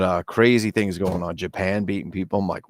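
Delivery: ordinary talk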